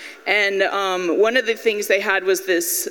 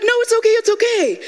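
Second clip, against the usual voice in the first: high, fast, mock-panicked protest; panicky pleading; 13 semitones higher, 4 dB louder, much faster than usual. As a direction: rush to hold her back, high-pitched. Child pleads with indignant mother.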